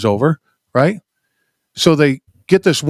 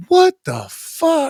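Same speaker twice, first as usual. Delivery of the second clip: stunned disbelief; much slower than usual. Real, not acted. Reacting to gruesome story.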